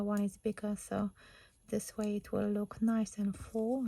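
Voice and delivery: ordinary delivery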